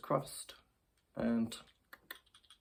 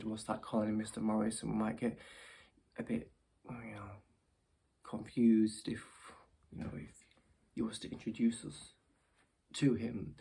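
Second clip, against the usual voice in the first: ordinary talk, nothing marked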